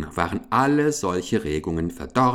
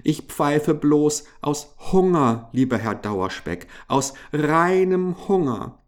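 higher-voiced pleading little mouse; 7 semitones higher than usual. A narrator's reading. Mouse begging Herr Dauerspeck out of hunger, pleading. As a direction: beg as a poor hungry mouse.

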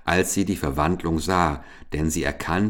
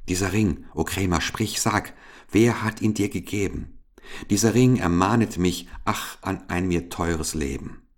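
usual speaking voice

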